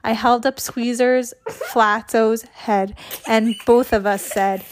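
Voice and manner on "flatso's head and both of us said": ordinary delivery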